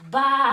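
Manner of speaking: loud drawn-out baa; full-voiced sheep imitation; sheep bleat. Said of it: "what does a sheep say?".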